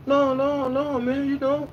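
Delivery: put-on high childish voice insisting on their age; squeaky kid voice; young kids' voice. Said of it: "And they be like".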